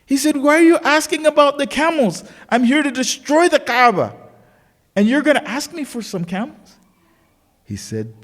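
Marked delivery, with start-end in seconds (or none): 0.4-6.8 high-pitched, indignant disbelief; incredulous. Acted. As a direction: act the king scoffing, pitch way up. Acted king incredulous at the request.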